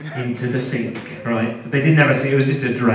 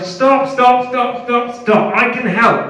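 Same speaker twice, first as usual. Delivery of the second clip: higher, louder frantic stops; mock panicked pleading; 10 semitones higher, 5 dB louder, slower than usual. Captive begging the interrogator to stop, mock panic. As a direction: beg him to stop, hammed-up panic. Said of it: "And I'm like".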